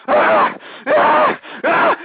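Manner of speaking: high, raw scream of fury; rage scream. Real, not acted. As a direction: scream out pure anger.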